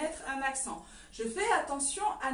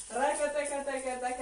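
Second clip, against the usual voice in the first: rapid "rakataka" percussion with the mouth; rhythmic vocal rattle; slower than usual. Shown as a demonstration. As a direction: sound out the hip trembling beat. Teacher voices the trembling rhythm.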